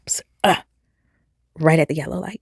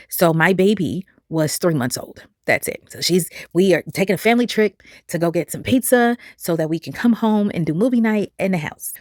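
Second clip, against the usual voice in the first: normal talking voice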